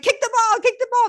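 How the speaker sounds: high, loud sideline shout at a hesitating player; yelling coach. Retold or imitated. Coach on the sidelines yelling at the player, loud shout. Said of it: "Your coach is like".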